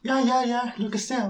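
high, hurried squeak; nervous squeak. Retold or imitated. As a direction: psych yourself up in a high voice. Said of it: "y dice". (voz aguda nerviosa)

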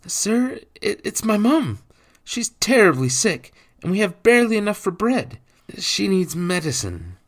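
high, loud, stammering start; hesitant pleading boy. A narrator's reading. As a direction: beg for your sick mother as a child. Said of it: "Jacques hesitated only a moment".

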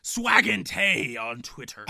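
slow pirate announcer. Acted. Co-host reading a listener's tweet, pirate voice. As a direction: read it out as a hammed-up pirate. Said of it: "you're still using your pirate voice".

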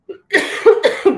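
sharp cough mid-sentence; loud sudden cough. Real, not acted. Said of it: "perdón".

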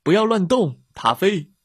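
shouting. A narrator's reading. Pilot to the dog being lifted, shouting. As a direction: shout a firm instruction from a distance. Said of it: "飞行员喊道".

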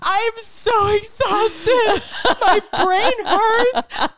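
high, slow, tearful wail; reenacted sobbing. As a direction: let it pour out like an overwhelmed cry. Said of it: "a whole nother rush of sobbing".

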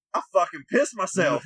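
loud, high, horrified realization; shocked shout. Retold or imitated. Welder telling the crew he found his pants soaked, shocked shout. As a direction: yell it like you just noticed your soaked pants.

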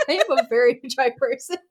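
loud, through laughter; laughing self-quote. Real, not acted. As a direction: repeat it while cracking up. Repeats own line, cracking up.

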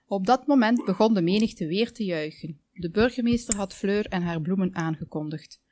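usual speaking voice

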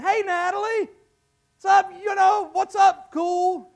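high, loud mock-chummy greeting; fake friend. False friend greeting Natalie, mock-chummy and loud. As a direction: call out like an overeager new pal. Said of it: "there'll be somebody, Natalie, that's gonna be your friend".

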